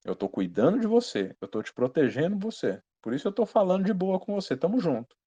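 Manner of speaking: soft, reassuring voice of God; gentle, soft-spoken. Acted. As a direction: speak softly, as a caring protector. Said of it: "Falando suave". (voz suave e tranquila, de boa)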